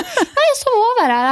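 mock a clueless party girl; lightly mocking girly reply; imitating the girl. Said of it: "Hun bare".

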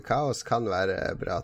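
ordinary talk

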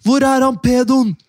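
put-on angry teen yelling. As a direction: imitate a mob of angry teens. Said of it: "så var de sånn". (sint imitert rop)

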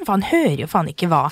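ordinary talk

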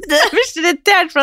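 amused mock irritation; laughing. Real, not acted. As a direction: laugh through the complaint. Still laughing, higher.

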